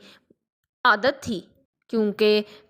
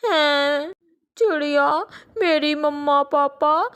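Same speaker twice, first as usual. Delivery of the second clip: a little crow faking grief for food; crying child voice, slowed, pretend tears; fake sobbing orphan; 3 semitones higher than usual.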